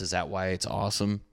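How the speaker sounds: ordinary delivery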